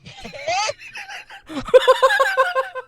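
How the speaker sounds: high, loud helpless laughter; shrieking laugh. Real, not acted. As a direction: howl with laughter. Laughing hard at the line.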